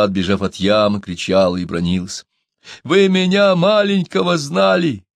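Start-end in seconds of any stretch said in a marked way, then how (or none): 2.8-5.0 high, anguished appeal; high, drawn-out lament; sobbing plea. A narrator's reading. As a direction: plead as if through sobs, pitch way up. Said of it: "рыдал Чумбока".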